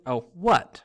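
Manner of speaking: sulky. A narrator's reading. Girl ghost answers a greeting, sulkily. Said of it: "she said sulkily".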